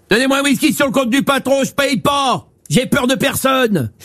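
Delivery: swagger like a little bully; mock tough guy. Skinny tough guy demands.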